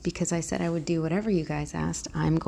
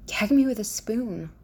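higher, hammed-up Valley Girl; 5 semitones higher, 4 dB louder than usual. Vlogger trying out a requested accent, mock Valley Girl. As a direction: put on a movie Valley Girl lilt. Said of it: "how to do Valley Girl".

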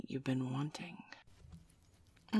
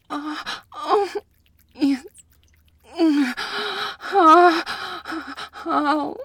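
loud, high moans of pleasure; loud high ecstatic moans; climax moans; 9 semitones higher, 10 dB louder, slower than usual. Acted. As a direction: let the moans peak and ring out.

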